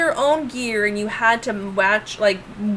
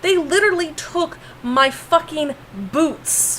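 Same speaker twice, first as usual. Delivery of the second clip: retold outrage; 6 semitones higher than usual. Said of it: "I'm like".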